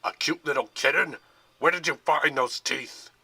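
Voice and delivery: loud furious roar. A narrator's reading. General to the guard, a loud furious roar. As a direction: bellow in outrage. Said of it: "the general roared".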